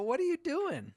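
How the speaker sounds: startled question jumping way up high; surprised dad. Retold or imitated. Dad finding his son boxing in the yard, startled and high. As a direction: shoot the pitch up in disbelief.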